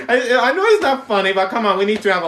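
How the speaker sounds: giggly loud excuse; laughing. Real, not acted. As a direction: laugh while begging them to lighten up. Laughing defense of joke.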